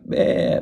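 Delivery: short imitated goat baa; quick demonstration bleat. Shown as a demonstration. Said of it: "made a noise like a goat".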